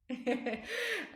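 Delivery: high, amused laugh. Real, not acted. Mother joking about lining up her kids, amused high laugh. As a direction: laugh at your own joke.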